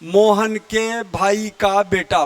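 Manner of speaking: calling someone by a long five-word name; slow calling out. Shown as a demonstration. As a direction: spell out the whole long name in a slow call. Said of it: "पांच शब्द बोल रहे हो".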